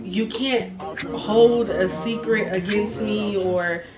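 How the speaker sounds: ordinary talk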